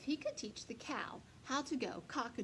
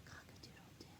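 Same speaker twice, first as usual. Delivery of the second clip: a tiny breathless whisper of a crow; 16 dB quieter, faster than usual. Acted. Rooster losing his voice, whispered crow. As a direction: rooster trying to crow but only whispers. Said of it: "a little whisper?".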